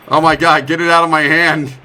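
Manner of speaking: loud, frantic complaint; real panic at a stuck item; exasperated shout at the game; frustrated outburst. Real, not acted.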